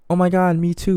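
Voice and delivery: high-pitched fake fan gush; feigned enthusiasm. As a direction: act like you care when you don't. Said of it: "act like you care about the artist when you don't".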